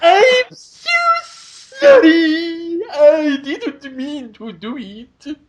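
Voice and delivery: fake-wail the apology like a blubbering clerk; mock sobbing apology. Mocking the publisher's apology email with hammed-up sobbing. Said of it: "Sent them an email Monday night".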